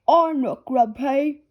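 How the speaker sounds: father insisting he is not grumpy; snorting denial. Acted. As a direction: deny it with a huff. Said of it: "snorts Daddy Pig".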